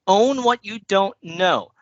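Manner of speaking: slow it down and lean on own; deliberate slow push on Own, falling at the end; slow emphatic advice. Shown as a demonstration. Speaker telling new presenters to own gaps, slow and emphatic. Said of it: "key advice delivered slowly".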